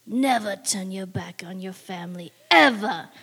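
a godfather warning, hushed then punched; mafia voice. Retold or imitated. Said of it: "in a mafia voice".

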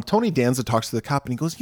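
usual speaking voice

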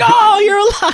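very high, loud exclamation; overjoyed mock cry. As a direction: exclaim in hammed-up joy and relief. Retelling Lambert's overjoyed reunion.

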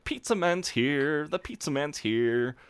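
high, drawn-out singsong announcement; imitating excited Jeremy. Jeremy announcing pizza at the doorbell, mock excited. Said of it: "Jeremy's like".

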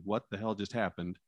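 ordinary talk, nothing marked

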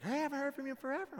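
a fast, bright-pitched hello from the ex-stepmom; surprised stepmom. Retold or imitated. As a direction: rattle it off high like a surprised stepmom. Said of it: "She's like".

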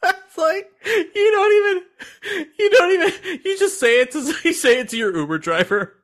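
crying with laughter, voice high; squeaking through helpless laughter; laughing too hard to get words out. Real, not acted.

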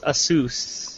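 "Asus" said as uh-SOOS; reported pronunciation. Shown as a demonstration. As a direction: say "Asus" with a weak first syllable, stress on SOOS. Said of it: "tells me it's pronounced".

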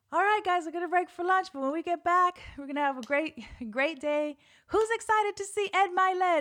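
high, loud event host hyping the room; Danny. Retold or imitated. Host announcing lunch break to the audience, hyped. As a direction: imitate an upbeat emcee rallying a crowd. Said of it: "Danny's ass says".

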